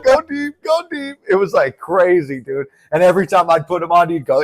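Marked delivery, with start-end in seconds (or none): 0.0-1.2 high panicked urging; hammed-up alarm, pitched high; mock panic. Reliving the terrifying vision for friends, high mock panic. Frightened reaction, retold.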